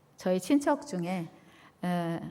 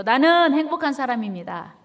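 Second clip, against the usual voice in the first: louder, higher declaration; loud together; 4 semitones higher, 5 dB louder than usual. Shown as a demonstration. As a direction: call it out loud and high with the congregation. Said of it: "다 같이 큰 소리로".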